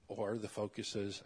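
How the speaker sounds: ordinary talk, nothing marked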